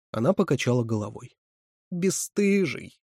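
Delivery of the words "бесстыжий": slow, high, drawn-out reproach; reproachful. A narrator's reading. Woman shakes her head at Ваня, reproachful. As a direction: stretch it out to shame him. Shaming the boy after shaking head.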